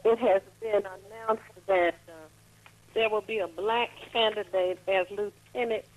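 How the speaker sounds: talking away from the mouthpiece; phone held away. Real, not acted. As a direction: voice far from the phone. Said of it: "you gotta talk into the phone".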